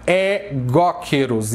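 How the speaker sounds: egóqueros opening on a single E, not "ai"; slow É-GÓ-queros for egóqueros; correct pronunciation. Shown as a demonstration. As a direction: teach egóqueros with a clean open E first. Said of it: "eles têm som de E".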